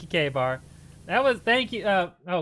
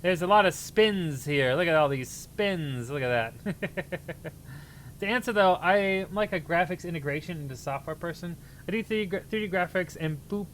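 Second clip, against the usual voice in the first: usual speaking voice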